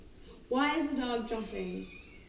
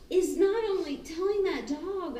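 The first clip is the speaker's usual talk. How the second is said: ordinary talk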